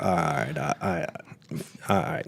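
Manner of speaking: quiet, low mumbled surrender; soft, halting reluctant agreement; resigned giving in. Retold or imitated. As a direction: give in reluctantly, under your breath. Giving in to wife, retold.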